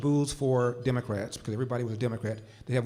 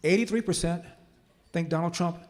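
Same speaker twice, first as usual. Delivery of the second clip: impassioned speech; 4 semitones higher than usual. Real, not acted. Speaker rallying his audience against being called fascists, impassioned. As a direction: drive the point home with rising force. Builds to passionate appeal on Democrats.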